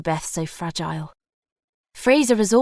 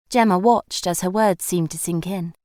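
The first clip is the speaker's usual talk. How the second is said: usual speaking voice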